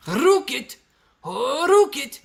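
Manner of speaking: high, rolled-R hammed-up British; mock British accent. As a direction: exaggerate a squeaky British rocket. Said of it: "how you say rocket in Britain".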